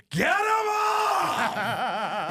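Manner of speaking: long, high-pitched heckling shout; heckler's yell. Retold or imitated. War vet heckles the comic on stage, drawn-out shout. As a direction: bellow it out, stretching the words. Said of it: "He just goes".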